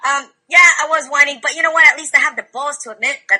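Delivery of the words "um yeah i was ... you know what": usual speaking voice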